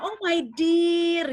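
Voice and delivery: a hammed-up loving sigh at someone; teasing sweet-talk, slowly stretched; mock endearment. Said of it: "kadang-kadang kamu bilang".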